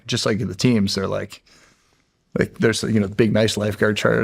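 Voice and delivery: normal talking voice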